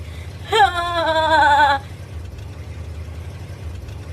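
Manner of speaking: drawn-out villain's laugh; villain cackling after his threat; evil laugh. Acted. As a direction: gloat with a wicked laugh.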